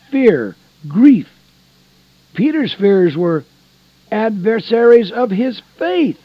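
loud, high, fervent sermon cadence; impassioned preacher to the flock; preaching at full force. Real, not acted. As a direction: lift the room with fervour.

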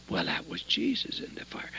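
soft, breathy whisper of awe; hushed reveal. Real, not acted. Preacher to congregation, the fire reveal, whispered in awe. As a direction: drop to a reverent whisper.

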